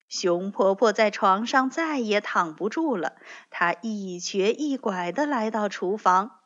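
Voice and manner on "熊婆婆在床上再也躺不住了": ordinary delivery, nothing marked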